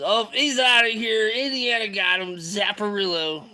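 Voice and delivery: high, loud play-by-play call easing down; gleeful crowing. Real, not acted. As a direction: celebrate someone being booted. Crowing as a viewer gets zapped.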